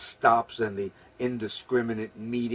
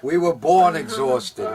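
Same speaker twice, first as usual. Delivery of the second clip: 1 emphatic; 4 semitones higher, 10 dB louder than usual. Acted. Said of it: "repeated line".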